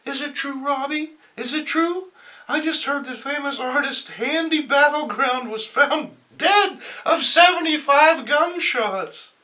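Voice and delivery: high, loud breathless shock; shocked outburst. Acted. M J rushing at Robbie's desk, shocked. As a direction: burst in with the news.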